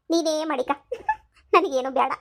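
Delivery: scornful laugh through the words; mocking laugh. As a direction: laugh off the taunt. Mocking taunt in retold quarrel.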